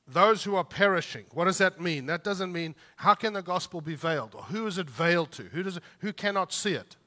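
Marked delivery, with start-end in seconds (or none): none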